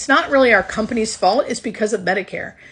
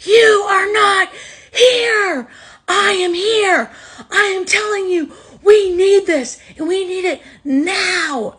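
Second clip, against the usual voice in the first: high, loud, tearful scream at the boss; yelling through tears, at the end of her rope; retold furious scream; 13 semitones higher, 7 dB louder, slower than usual. Nurse screaming at her boss over the phone, crying and furious. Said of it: "I screamed at my boss".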